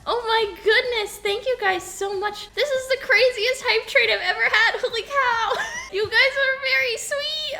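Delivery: high, loud, thrilled thank-yous; excited thanks. Real, not acted. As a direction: shout your thanks, overjoyed. Hype train gifts, excited squeals.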